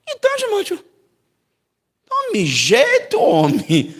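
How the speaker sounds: high, loud, slow rebuke; stern Holy Spirit. Retold or imitated. Spirit telling Timóteo to shape up, high and drawn out. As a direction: draw it out like a fond scolding. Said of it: "O Espírito Santo fala comigo assim".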